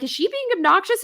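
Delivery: high, quick rising question; mock incredulous question. Acting out asking the fans around.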